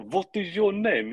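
high, slow imitation German; mimicking a botched attempt at German; mock German accent. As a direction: draw out each word in a hammed-up German accent. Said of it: "Dale's like".

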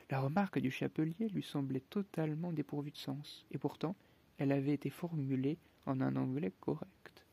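ordinary delivery

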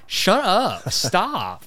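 high, exasperated mock yell; mock irritation. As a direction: tell them off in a hammed-up whine. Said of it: "people like me just like".

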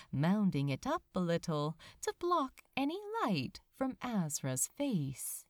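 plain reading voice

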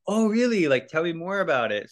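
high, mock-curious voice; sarcastic lady officer. Border officer baiting him, high and mock-curious. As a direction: feign interest to trap him.

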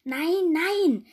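high, loud, exasperated outcry; acted desperate queen; desperate hiss. A narrator's reading. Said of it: "zischte die Königin verzweifelt".

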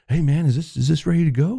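high-pitched casual asking; imitating the friend. Friend asks casually, retold.

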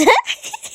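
high burst of laughter; real laughing fit. Real, not acted. Reader breaking off from the book, helpless laughter. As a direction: burst out laughing mid-sentence. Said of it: "She can't stop laughing".

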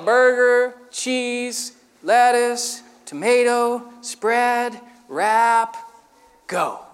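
each word drawn out, long gaps between; laboriously slow. Acted. Novice cook assembling Whoppers word by word, laboriously slow. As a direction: plod through the order one word at a time. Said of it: "I can't make Whoppers that fast".

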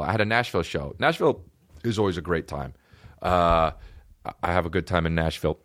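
ordinary talk

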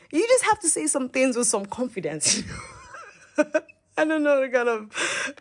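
high giggly voice; laughing. Real, not acted. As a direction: laugh through the words. Laughing at teen self.

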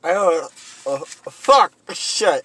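talking around a pencil in the mouth; pen in teeth. Real, not acted. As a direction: mumble through a pencil held in the teeth. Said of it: "Maybe take the pencil out of your mouth".

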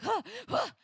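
two high, breathy "huh" bursts; high-pitched mock scare noises; imitated scare noise. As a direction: mimic monsters jumping out with short high huffs.

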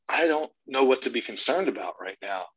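ordinary talk, nothing marked

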